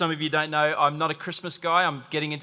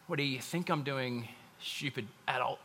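imagined eye-roll; 3 semitones lower, 9 dB quieter than usual. Retold or imitated. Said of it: "She didn't say that".

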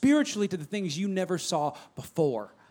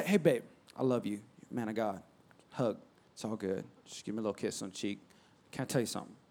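soft, much quieter loving voice; gentle wife; 8 semitones lower, 9 dB quieter than usual. Retold or imitated. Wife gently calming her husband, much softer. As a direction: coax him tenderly, barely above a murmur. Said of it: "my wife will come along and she'll say".

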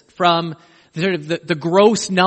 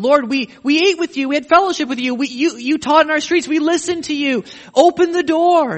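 loud and higher, insistent begging; pleading; 9 semitones higher, 5 dB louder than usual. Acted. Crowd pleading with the Lord, loud insistent begging. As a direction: boast and beg for entry.

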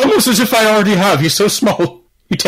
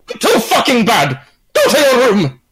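ordinary talk, nothing marked

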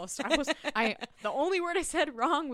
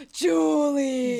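loud shouted call of a name; 4 semitones higher, 13 dB louder than usual. Retold or imitated. Retelling the boyfriend yelling outside her house, loud.

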